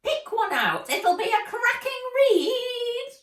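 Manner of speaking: very high, loud, gleeful elf voice; cackling elf. Acted. Elf urging Nat to pick a book, high cackling. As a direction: sell it with a witchy cackle. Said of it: "said the elf with a loud cackle".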